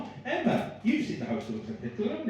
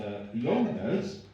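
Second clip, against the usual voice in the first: normal talking voice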